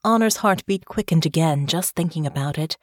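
ordinary delivery

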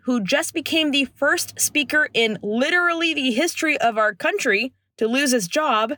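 ordinary delivery

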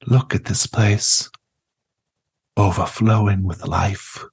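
lightly voiced gentle old grandmother; warm serene goddess voice. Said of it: "She says".

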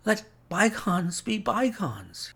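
high, strained invalid's plea; feeble pleading. A narrator's reading. Sick Holmes begging his visitor, high feeble plea. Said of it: "he whispered".

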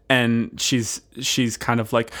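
ordinary delivery

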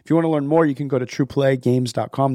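ordinary talk, nothing marked

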